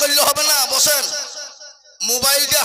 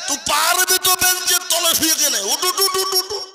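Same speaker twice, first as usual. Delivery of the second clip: scolding professor; 6 semitones higher than usual. Retold or imitated. Professor yelling at the boy under the bench, high and scolding. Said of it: "বলে".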